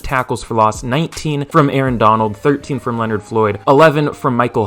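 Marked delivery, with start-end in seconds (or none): none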